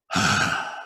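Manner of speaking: a long breathy sigh; mock weary sigh; imitated creature sigh. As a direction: breathe out like a giant sighing. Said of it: "I hear a sigh".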